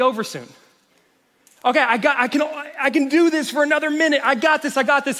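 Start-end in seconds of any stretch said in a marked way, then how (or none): 1.6-5.2 high, louder mock pep talk to himself; self pep talk. Pastor mimics himself gritting through a plank, mock self-encouragement. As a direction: psych yourself up mid-plank.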